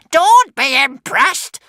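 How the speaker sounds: high, loud, slow witch voice; hissing witch. A narrator's reading. Witch warns the impressed crowd, hissing loudly. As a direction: scold them as a high-pitched witch. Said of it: "hissed the witch".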